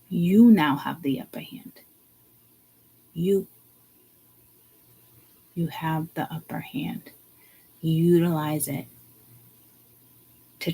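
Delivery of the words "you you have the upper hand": breathy whispered emphasis; hushed, pointed whisper. Real, not acted. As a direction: drop to a whisper to drive it home.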